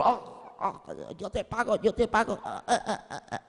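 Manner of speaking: faint, strangled gasps between words; being choked. Acted. Debtor held by the neck promising to pay, choking. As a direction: gasp it out softly, barely able to speak. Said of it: "No podía ni hablar porque el otro lo tenía por el cuello".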